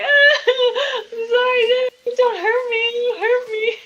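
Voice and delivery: high-pitched, hammed-up frightened wailing; crying. Caught wife pleading not to be hurt, acted crying. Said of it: "she starts crying".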